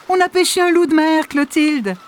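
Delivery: very high excited grandmother; excited exclamation. Acted. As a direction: exclaim with delight. (grand-mère excitée)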